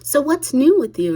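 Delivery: high, loud, fake-bright small talk; a hint of an overly chipper mother; mock-cheery mom. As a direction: lightly parody the mother's breezy question. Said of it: "being like".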